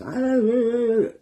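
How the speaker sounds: imitating a frantic caller, high and loud, slowly; high panicky yelps she can't get past; frantic girlfriend on the phone, too scared to form words; panicked wordless gasps. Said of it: "I couldn't understand her".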